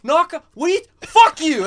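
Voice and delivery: groggy confusion flaring into shouting; confused, offended yell. Retold or imitated. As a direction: imitate someone woken and instantly combative. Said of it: "he was so confused and just didn't like my tone".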